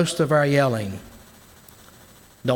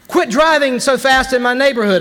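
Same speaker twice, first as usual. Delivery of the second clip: loud, high yell at a speeding driver; shout; 8 semitones higher, 4 dB louder than usual. Shown as a demonstration. Preacher shouts at a speeding driver to show a loud yell. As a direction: bellow it down the street. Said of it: "I can shout that".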